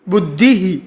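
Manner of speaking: बुद्धि ही with a light ही at the end; visarga echoing the vowel. Shown as a demonstration. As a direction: echo the ई after बुद्धि. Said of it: "छोटा ही उच्चारण करना है".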